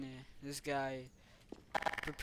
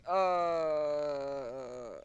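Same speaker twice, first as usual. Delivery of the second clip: long wail that falls away at the end; pretend dying groan; 5 dB louder than usual. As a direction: play out a drawn-out death. Figure dying in play.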